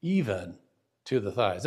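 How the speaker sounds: EVEN set apart, low and deliberate, pause before "to the thighs"; focus emphasis. Shown as a demonstration. Teacher showing the verse's focus emphasis, EVEN set apart. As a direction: isolate "even", then land "to the thighs". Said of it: "with this focus emphasis".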